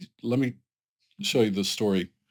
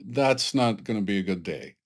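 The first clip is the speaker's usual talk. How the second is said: ordinary talk, nothing marked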